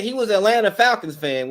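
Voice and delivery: ordinary talk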